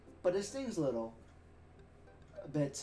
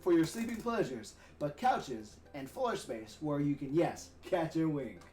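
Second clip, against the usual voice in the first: cheesy announcer voice. Acted. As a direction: draw it out like a cheesy TV salesman. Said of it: "do my best cheesy announcer voice".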